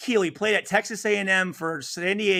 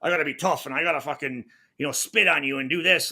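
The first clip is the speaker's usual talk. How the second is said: tough-guy posturing; 5 semitones lower than usual. Retold or imitated. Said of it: "he didn't have, like, this".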